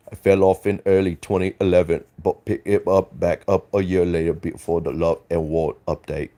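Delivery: put-on light British accent on a chat read; mock British accent. Streamer reading a viewer's chat in British accent. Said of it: "I was trying to do a British accent".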